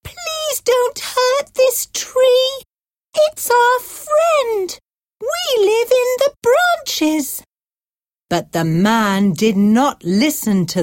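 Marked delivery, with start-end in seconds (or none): 0.0-7.4 pleading birds. A narrator's reading. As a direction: plead like tiny birds for their home. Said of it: "The birds cried".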